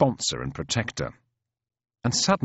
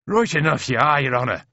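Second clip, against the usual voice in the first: high, loud Irish brogue; Shaughnessy agreeing; 6 semitones higher, 5 dB louder than usual. A narrator's reading. Departing private confirming it to the officer, heartily agreeing. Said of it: "agrees the departing Shaughnessy".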